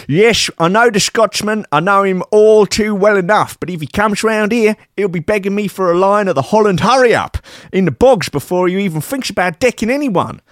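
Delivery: high comic Dutch voice. Acted. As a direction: sneer like a smug villain. Character voice of [name].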